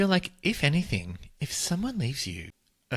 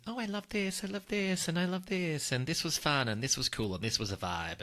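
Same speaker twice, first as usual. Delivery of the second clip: high gushing list; 8 semitones higher, 5 dB quieter than usual. Retold or imitated. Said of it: "I'd be like".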